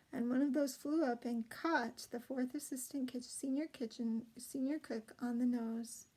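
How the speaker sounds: ordinary delivery, nothing marked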